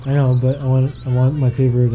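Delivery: ordinary talk, nothing marked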